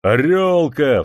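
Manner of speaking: a friend bellowing a greeting; joyful cry. A narrator's reading. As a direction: shout the name in glad surprise. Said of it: "возопил уралец".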